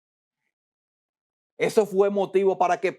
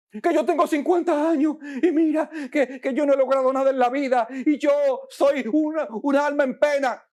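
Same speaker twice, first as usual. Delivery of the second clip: higher, half-laughing lament; mock someone wallowing in self-pity; preacher mimicking a fifty-year-old lamenting his life; self-pitying complainer; 6 semitones higher, faster than usual. Mimics a despairing person.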